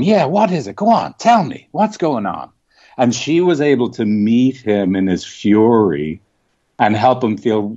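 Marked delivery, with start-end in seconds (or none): 0.0-2.5 put-on higher, inviting mother's voice meeting an angry kid; mother calmly drawing him out. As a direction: coax the furious boy to open up, higher pitched. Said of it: "my mother just getting up and going".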